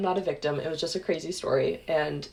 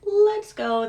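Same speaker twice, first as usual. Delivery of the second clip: high, loud stretched-out plea to leave; squeaky-high insistent group urging; urgent urging; 14 semitones higher, 7 dB louder than usual. Retold or imitated. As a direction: pipe up high and push to get out. Retold urging friends to leave.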